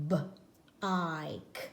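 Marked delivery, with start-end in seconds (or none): none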